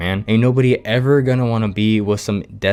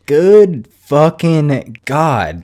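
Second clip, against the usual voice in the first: much higher, louder, slow disbelieving exclamation; stunned outburst; 6 semitones higher, 6 dB louder, much slower than usual. Real, not acted. Reacting to her rude reply, a stunned, loud, slow exclamation. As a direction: drag it out in shocked disbelief.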